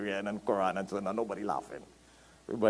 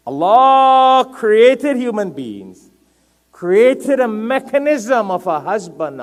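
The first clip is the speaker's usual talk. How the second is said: loud, high and drawn-out sermon delivery; impassioned preaching; 11 semitones higher, 13 dB louder, slower than usual. Real, not acted. Preacher proclaiming how Allah created human beings, loud and high. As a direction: proclaim it slowly at full force.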